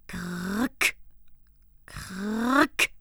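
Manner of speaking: rasping grrrk of a crank; crank noise. Acted. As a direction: imitate the crank creaking. Cranking again. (knarrende Kurbel)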